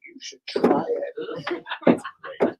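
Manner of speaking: very slow, high, whispered tease; teasing whisper. Retold or imitated. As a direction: coax them slyly in a whisper. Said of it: "I'm like".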